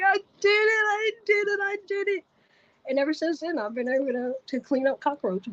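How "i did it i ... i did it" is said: very high, squealed mock cry; repeated wail of relief, pitched way up; trembling relief. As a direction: act out overwhelmed, tearful triumph. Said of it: "I'm just trembling".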